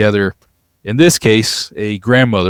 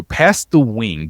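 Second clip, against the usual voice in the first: ordinary talk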